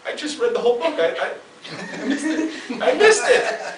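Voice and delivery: high, laughing, rising into a squeak; cracking up at missing it; laughing disbelief. Retold or imitated. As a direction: laugh at your own confusion. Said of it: "And I'm like".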